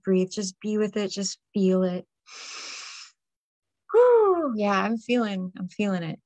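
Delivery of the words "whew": big high exhale whew; loud whew exhale. Real, not acted. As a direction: blow the air out with relief. Releasing after breathing exercise.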